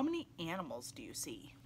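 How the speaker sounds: soft whispered question. Acted. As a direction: whisper it like a secret. Quiz question to children.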